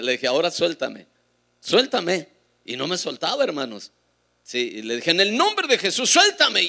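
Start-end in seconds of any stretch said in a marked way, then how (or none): 0.3-1.1 a first firm order to let go; firm, slightly raised instruction; firm command. Retold or imitated. Ordering hand to be released. 1.6-2.3 louder, higher, insistent order; sharply raised, urgent command. Retold or imitated. Repeats the order, not obeyed. 5.1-6.6 high, forceful shouted command; loud, urgent order in prayer. Retold or imitated. Commands release in Jesus' name.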